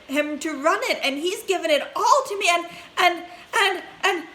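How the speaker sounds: high, loud tumbling rush of news; can't get the words out fast enough; boy gushing happy news to mother; breathless excitement. A narrator's reading. Charlie excitedly tells mother news.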